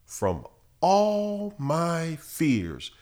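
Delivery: high, drawn-out preacher's cadence; fervent preaching. Real, not acted. Preacher assures listeners of deliverance from fear, in soaring, drawn-out preaching. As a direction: stretch each phrase and build to a loud peak.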